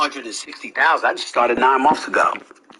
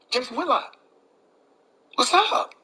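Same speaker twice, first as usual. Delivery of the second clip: mocking callout; 10 semitones higher than usual. Real, not acted. Calling out rival by name.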